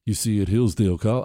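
low, restrained, put-on gentle tone; mock loving voice. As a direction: hold back as if tenderly explaining. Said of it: "I can't do the loving voice for too long".

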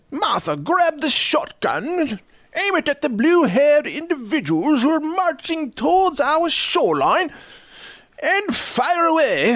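high, drawn-out comic British; hammed-up British officer giving orders; slow, fluting mock-British accent. Shown as a demonstration. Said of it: "can't do a British accent".

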